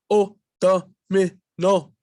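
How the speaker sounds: O domino said slowly, chopped into spots: o, do, mino; choppy, broken up. Shown as a demonstration. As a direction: stumble through O domino in broken pieces. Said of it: "broken up in spots".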